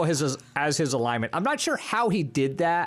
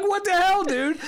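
high, louder exasperated outburst; mock outrage; 4 dB louder than usual. Imitating players reacting to a party member's attack, mock outrage. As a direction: play the appalled teammate, pitched way up.

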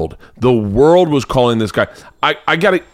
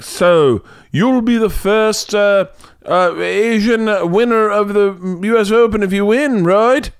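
much higher, slow, cartoon villain reporter; Gargamel voice; 9 semitones higher, slower than usual. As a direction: play the clueless interviewer as a squeaky cartoon baddie. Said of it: "I think I just did, uh, Gargamel".

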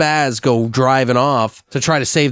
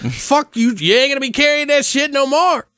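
much higher taunting jeer; gloating taunt. Acted. Eric jeering at the beast after crushing its arm, gloating taunt. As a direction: rub it in after running him over.

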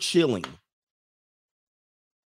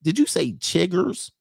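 mock double take; 5 semitones higher, 3 dB louder than usual. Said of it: "You be like".

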